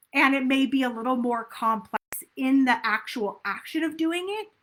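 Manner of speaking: normal talking voice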